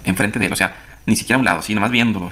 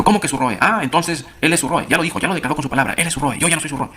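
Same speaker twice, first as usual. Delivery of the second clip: high, indignant retort, pitch climbing; angry outburst; 7 semitones higher than usual. Retold or imitated. Palacios scolding the narrator over "mi Roe", angry and high. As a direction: fire it back as a jealous accusation. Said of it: "se enojó tanto, explotó".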